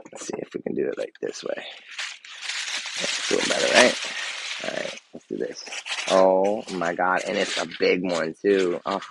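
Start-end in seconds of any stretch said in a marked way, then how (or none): 0.1-5.6 whisper. Real, not acted. Quietly handling a fragile gift.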